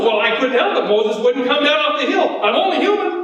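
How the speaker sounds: high-pitched, whining self-defence; mock-innocent protest; Aaron's excuse. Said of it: "his brother Aaron said".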